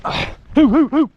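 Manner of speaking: high, loud hooting call; calling hoots. Real, not acted. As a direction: hoot loudly to urge the dogs on. Calling the dogs in the field.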